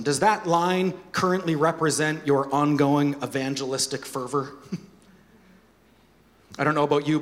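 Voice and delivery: ordinary delivery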